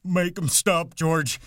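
high, loud cry for help; panicked wail to a protector; terrified bleat. A narrator's reading. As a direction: bleat it in terror, pleading. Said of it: "He cried".

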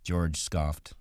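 plain reading voice

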